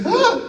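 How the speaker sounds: short high mock shriek; a quick high startled shriek; hammed-up gasp of fright; mock scream. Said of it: "she goes".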